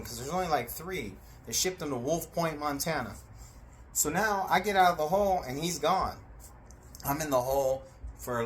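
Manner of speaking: ordinary talk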